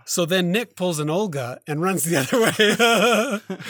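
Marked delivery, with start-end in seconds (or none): 1.8-3.7 higher, laughing through the words; breaking into laughter. Real, not acted. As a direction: crack up while telling it. Teller cracks up at retold flight.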